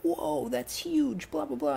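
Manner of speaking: very high, slow, drawn-out whoa of awe; amazed whoa. Retold or imitated. Mike gawks at the giant spider leg, slow high awe. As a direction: stretch it out in goofy wonder. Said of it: "And Mike's like".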